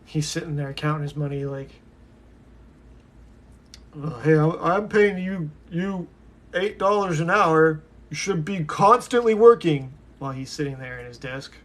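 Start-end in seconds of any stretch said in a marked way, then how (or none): 4.0-9.9 loud, slightly raised boss rant; corporate boss. Acted. Imagining the CEO scolding his underpaid worker, bossy and loud. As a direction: bark it like a boss demanding work.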